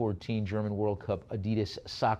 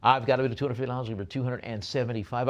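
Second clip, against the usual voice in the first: ordinary talk, nothing marked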